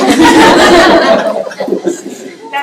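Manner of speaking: airy real laugh; amused breathy chuckle. Real, not acted. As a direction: laugh off your own joke. Laughing after joke.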